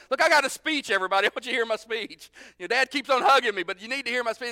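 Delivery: high, loud, insistent son; prodigal son. Acted. Prodigal son with a speech prepared, loud and insistent. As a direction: eagerly demand to be heard. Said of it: "the prodigal son's kinda going".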